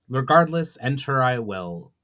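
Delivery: ordinary delivery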